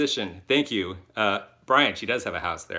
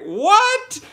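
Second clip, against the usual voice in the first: retold disbelief; 9 dB louder than usual. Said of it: "And I was like".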